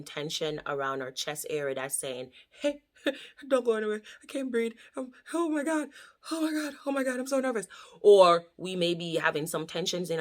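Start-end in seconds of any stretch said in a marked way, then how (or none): 2.5-7.7 high, fast, breathless panic; mock panic. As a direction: play a panicking nervous wreck. Voicing panic inside the chest.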